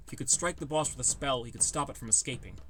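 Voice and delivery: ordinary delivery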